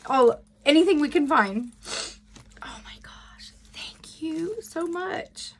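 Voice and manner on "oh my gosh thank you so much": breathless whispered thanks, slow; barely able to get the words out, whispering; overwhelmed whisper. Real, not acted. Whispering thanks to the friend who sent the gift, overwhelmed.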